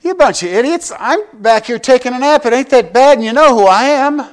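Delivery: hammed-up rebuke of foolish disciples; a pastor imitating an exasperated scolding; mock scolding rebuke. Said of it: "it's almost as if he said".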